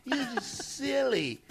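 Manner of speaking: high, soft mock-feminine coo; fine woman flirting. Host imitating fine women at a reunion, high flirty voice. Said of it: "and then they".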